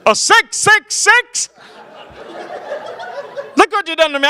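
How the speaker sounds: hammed-up preacher. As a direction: preach the horror of 666. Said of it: "Not for my dad".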